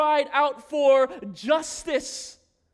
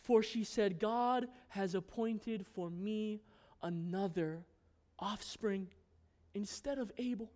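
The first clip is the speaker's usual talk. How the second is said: soft scripture reading; 6 semitones lower, 10 dB quieter than usual. Acted. Preacher closing the Cain and Abel story by reading scripture: very soft. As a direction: read it gently, almost under breath.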